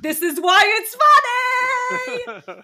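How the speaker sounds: shouted, high and drawn out; excited shout. Retold or imitated. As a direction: belt it out high, stretching the last word. Punchline of her retold reaction.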